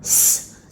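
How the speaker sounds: स said as a voiceless hiss, no vowel; letter sound. Shown as a demonstration. Teacher showing pupils the sound of S, voiceless. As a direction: give स as a bare unvoiced hiss. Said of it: "एस का sound स".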